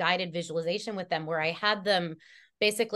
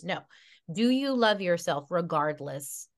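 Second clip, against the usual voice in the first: ordinary talk, nothing marked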